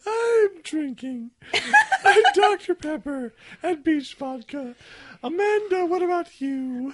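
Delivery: giggling high through the words; laughing. Real, not acted. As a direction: laugh while answering. Laughing through his answer.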